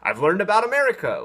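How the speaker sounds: hammed-up clueless cartoon fan; naive viewer. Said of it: "they're not gonna be like".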